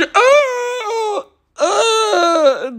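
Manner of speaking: long drawn-out high wailing cry of outrage; anguished cry. Retold or imitated. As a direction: wail it out, high and stretched, mock despair. Outraged at the cop's insult.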